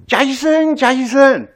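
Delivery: calling a name across the building, high and loud. Retold or imitated. Secretary calling the doctor at dawn, a mock call out. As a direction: shout the name to wake him.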